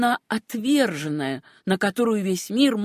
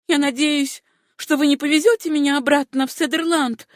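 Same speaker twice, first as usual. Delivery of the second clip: horrified; 6 semitones higher, 3 dB louder than usual. A narrator's reading. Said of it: "в ужасе промолвила Сюзанна".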